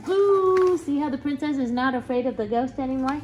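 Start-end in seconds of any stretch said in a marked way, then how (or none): 0.1-0.8 loud, high "boo"; big high-pitched playful scare; princess's boo. A narrator's reading. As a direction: shout boo to scare a ghost.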